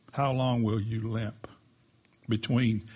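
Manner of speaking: usual speaking voice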